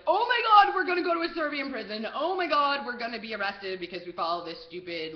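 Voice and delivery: loud, high wail easing off as it goes; mock panic. Frightened companions fearing arrest, hammed-up panic. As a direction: imitate panicked friends, crying out high and loud.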